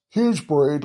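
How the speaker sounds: ordinary delivery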